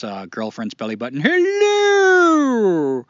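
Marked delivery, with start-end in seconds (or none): 1.2-3.1 huge high booming greeting. Retold or imitated. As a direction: boom it out over the top. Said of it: "that booming voice that Seinfeld had".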